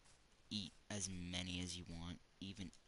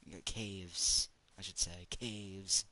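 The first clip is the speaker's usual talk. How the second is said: louder, slow thrilled emphasis on caves; excited; 8 dB louder, slower than usual. Real, not acted. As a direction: savour the find out loud. Excited cave discovery.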